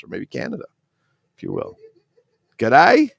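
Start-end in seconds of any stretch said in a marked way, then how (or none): 2.6-3.1 loud, high Australian greeting; Australian accent. Shown as a demonstration. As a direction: put on an Aussie accent, rising high. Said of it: "That's Australian".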